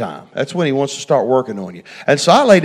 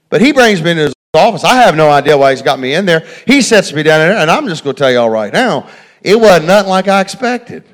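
rising, louder preacher's build; preaching; 3 semitones higher, 5 dB louder than usual. Acted. Preacher telling the church about his boss, preaching louder.

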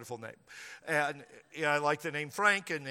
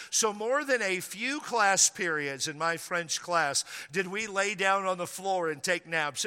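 usual speaking voice

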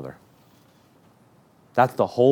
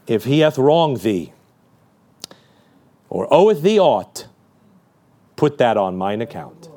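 tender plea; 4 semitones lower than usual. Pretend. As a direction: plead gently for the sinner. Christ interceding, slow tender reading.

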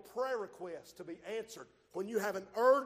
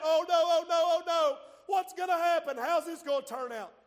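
high, loud mock panic; hammed-up panic, oh no oh no; panicking flesh; 8 semitones higher, 8 dB louder than usual. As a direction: play the frantic worrier. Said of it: "when your flesh is panicking".

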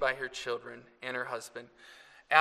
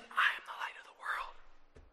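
breathy whisper from the front pew; 5 dB quieter than usual. Retold or imitated. Mother prompting her son from the front pew, whispering. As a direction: mouth the cue, barely a breath. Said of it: "She said ... whispers".

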